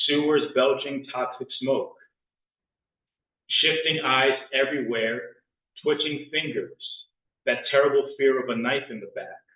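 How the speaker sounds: loud, dramatic slam poetry; spoken word poetry. Acted. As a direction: declaim it like a grim city epic. Performs poem Hell in Port Authority.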